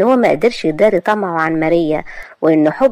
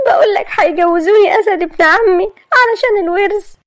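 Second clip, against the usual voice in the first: put-on high, tearful girl explaining; distressed daughter on the phone; upset young woman; 11 semitones higher, 3 dB louder than usual. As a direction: pour out the story, distressed.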